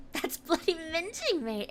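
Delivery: hammed-up British accent, laughing; mock British accent. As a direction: play a cheeky Brit in a British accent. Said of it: "How very British".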